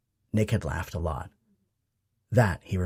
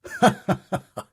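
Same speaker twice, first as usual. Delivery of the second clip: booming laugh; 12 semitones higher than usual. A narrator's reading. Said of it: "His dad's booming laugh".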